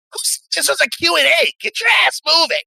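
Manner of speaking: very high, fast shouted squeal; high-pitched panicked goon voice; agitated henchman. Acted. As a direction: bark orders in a frantic, squealing voice. Voicing an NPC barking orders.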